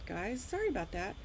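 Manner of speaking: usual speaking voice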